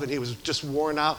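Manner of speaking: normal talking voice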